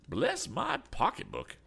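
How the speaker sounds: slow, high-pitched exclamation; astonished cry. A narrator's reading. Eccentric man amazed at the treasure claim, crying out. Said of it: "cried Mister Damon".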